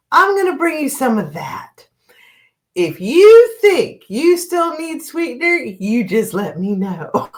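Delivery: high, drawn-out mock Southern drawl; horrible Southern accent. Waitress offering sweet tea to a customer, horrible Southern accent. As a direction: imitate a Southern waitress, slow and high-pitched. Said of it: "my horrible accent".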